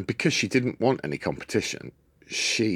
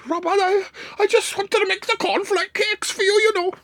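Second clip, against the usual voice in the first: Nine; 4 dB louder than usual. Retold or imitated. Aunt explaining burnt cornflakes to the dad, high falsetto. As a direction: wail it like a fussing old aunt. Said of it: "She's Jamaican now".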